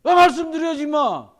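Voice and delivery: high, loud exasperated scolding. Retold or imitated. Pastor scolding a teen on a phone at retreat, loud scold. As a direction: tell the kid off sharply.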